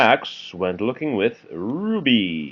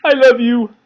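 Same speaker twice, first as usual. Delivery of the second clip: much higher, louder little Max; Max's squeaky love; 10 dB louder than usual. Acted. Parent reads Max to child, high loving squeal. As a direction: squeal it like a small stuffed bunny. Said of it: "said Max".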